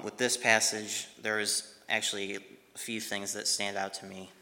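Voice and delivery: ordinary talk, nothing marked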